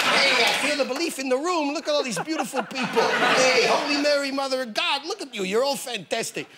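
high, hammed-up showbiz voice; a gushing stage host, lightly overdone; quoting a showman host. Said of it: "and he said".

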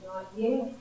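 low, soft, unhurried refusal; quiet. A narrator's reading. Master answering the dizzy clay, quiet. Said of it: "quietly said".

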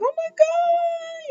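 squealed oh my God. Retold or imitated. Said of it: "And Brooklyn was like".

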